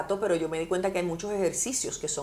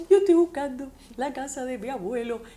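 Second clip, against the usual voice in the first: tearful; 6 semitones higher than usual. Retold or imitated. Retells herself crying to man.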